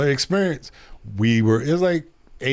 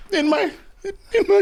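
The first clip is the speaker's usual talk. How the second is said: high-pitched mock German accent; terrible German accent. S1 riffs on a theme rap, terrible German accent. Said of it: "I got a terrible German accent".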